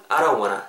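"I don't wanna" as "aro-wanna"; casual linked "aro wanna"; reduced "wanna". Shown as a demonstration. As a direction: melt every t out of "I don't wanna". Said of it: "까지 발음이 됩니다".

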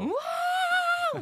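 very high, long, loud scream; demonstrated scream. Shown as a demonstration. Showing a scream unfit for mountaintops, long high screaming. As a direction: scream it out and hold it. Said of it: "여기 scream은 또 안 어울려요".

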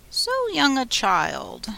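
high, slowly drawn-out gentleman's voice. A narrator's reading. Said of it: "said the gentleman sitting opposite".

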